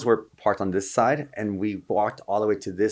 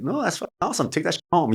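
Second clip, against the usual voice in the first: excited; 10 semitones higher than usual. Retold or imitated. Excited CIA woman quoted.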